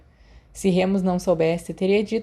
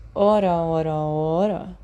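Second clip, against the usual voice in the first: smirking; 2 semitones lower, slower than usual. Acted. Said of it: "Snape sorriu".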